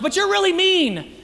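mock wounded whine; hurt accusation. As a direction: complain like a hurt friend. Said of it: "they're like".

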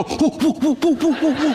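high, giddy whoo calls; mock whooping at an usher. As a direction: whoop like a rowdy fan in church. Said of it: "go up to an usher and go".

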